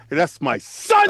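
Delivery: That's my son slow, every word weighted; with conviction. Shown as a demonstration. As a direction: drag out "That's my son" like a proud father. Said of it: "say it with that conviction".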